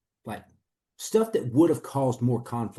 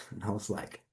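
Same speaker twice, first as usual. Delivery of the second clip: usual speaking voice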